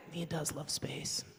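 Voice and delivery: soft, slow, resigned sigh of a line; resigned family. Retold or imitated. Family of the Mars dad, soft and resigned. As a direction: say it quietly, giving up. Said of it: "his family was just like".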